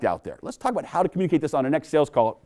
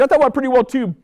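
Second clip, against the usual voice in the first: higher, louder mock manager; 9 semitones higher, 5 dB louder, faster than usual. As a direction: play the pleased boss. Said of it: "And I say as his manager".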